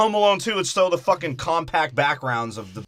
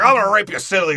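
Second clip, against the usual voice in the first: louder, higher hammed-up threat; mock growled threat; 7 semitones higher, 8 dB louder than usual. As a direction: play a campy villain. Recorded Uncle Frank voiced.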